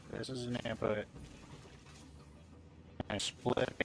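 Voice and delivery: glitchy lagging mic; choppy robotic connection. Real, not acted. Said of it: "your voice is all robotic".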